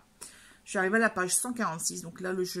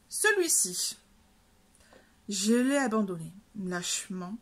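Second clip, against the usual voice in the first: ordinary delivery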